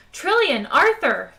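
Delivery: loud call across the ship; shouting. Acted. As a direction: call them loudly from the doorway. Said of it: "he shouted".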